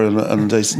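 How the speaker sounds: ordinary talk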